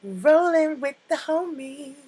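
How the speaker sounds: put-on high, loud valley-girl line; quoting Cher. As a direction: toss it off like a bubbly Beverly Hills teen.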